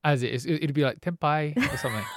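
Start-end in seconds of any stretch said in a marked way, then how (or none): 1.0-1.6 "Tempeh" in mock Indonesian, ending in "pie"; put-on Indonesian accent. Shown as a demonstration. Host guesses the non-Aussie way to say "Tempeh", mock Indonesian accent. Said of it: "That sounds more cultural".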